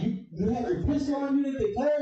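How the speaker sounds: high, disbelieving question; mock astonishment; shocked question. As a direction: react like you can't believe it. He asks, shocked.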